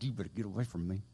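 low, fast parental scolding; mad parent. Retold or imitated. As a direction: imitate a parent fed up with a child. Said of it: "so mad at your child".

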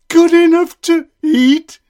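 high, loud alarmed shriek. A narrator's reading. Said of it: "shrieked the gingerbread man".

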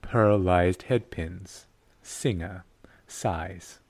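low, soft, slow erotic reading. Shown as a demonstration. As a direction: read the label slowly and seductively.

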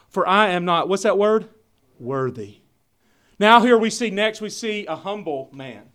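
loud, driving sermon delivery; booming pulpit voice; full-force preaching. Acted. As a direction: preach it out to the congregation.

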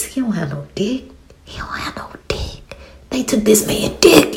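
hushed whisper, like sharing a scandal. Real, not acted. To viewers, whispering the outrageous detail. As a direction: whisper it like a shocking secret.

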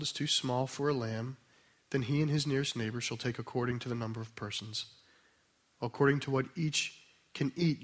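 soft, low, measured reading with long pauses between phrases; slow scripture reading. Real, not acted. Preacher asking listeners to be with the scripture, slow reverent reading. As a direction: read the verses quietly and unhurried, letting each phrase settle. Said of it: "I'm gonna read them slowly".

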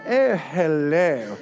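slow, plummy upper-class "Oh, hello" drawn out; posh voice. Retold or imitated. Pastor mimics Belper locals, slow posh accent. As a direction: put on a posh leafy-suburb greeting. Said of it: "if you do talk like that".

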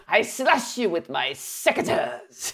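put-on slow, theatrical Spanish-accented swordsman; hammed-up Spanish menace; Zorro. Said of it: "I want to turn into Zorro".

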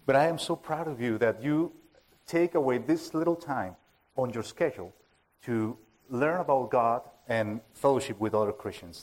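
normal talking voice

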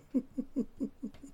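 high burst of laughter; real laugh. Real, not acted. Laughing at his own joke about lying, high laugh.

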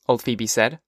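usual speaking voice

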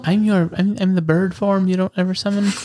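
lightly voiced effeminate bird spirit; a hint of a soft effeminate voice; soft feminine male voice. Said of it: "a very effeminate voice".

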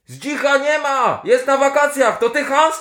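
high, slow shouted answer; shouting back. Acted. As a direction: call back across the trenches. Pole shouts back across trenches.

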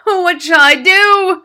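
high, drawn-out loud wail; father wailing. A narrator's reading. Father fears going bald, wailing. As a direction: wail it slowly in despair. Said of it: "wailed the father".

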